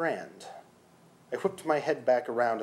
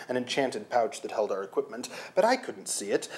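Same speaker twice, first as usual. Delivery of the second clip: usual speaking voice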